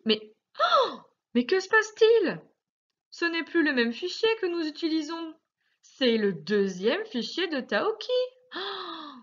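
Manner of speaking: high, playful gasp of surprise; mock surprise. Teacher fakes surprise at the new workbook, high and playful. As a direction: pretend to be amazed for the child.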